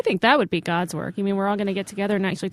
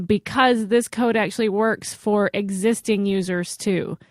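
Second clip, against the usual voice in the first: ordinary talk, nothing marked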